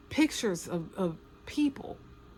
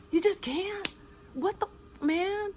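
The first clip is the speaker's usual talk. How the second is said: high outraged disbelief; 12 semitones higher than usual. Real, not acted. To viewers about abusers, high and appalled.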